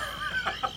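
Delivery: leises, tonloses Kichern; leises Lachen. Acted. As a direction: lach gedämpft in dich hinein. Kollege neckt sie nach Einsatz. (verhaltenes Lachen)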